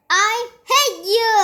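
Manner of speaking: shriek. A narrator's reading. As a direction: explode at your brother. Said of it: "shrieked Henry".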